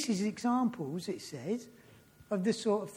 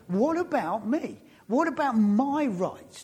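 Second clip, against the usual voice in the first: mock self-pitying protest, a touch louder; indignant inner voice; 4 semitones higher, 6 dB louder than usual. Preacher voicing his proud inner self, mock indignant. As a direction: whine about your own rights, half in jest. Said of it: "I'm still going inside".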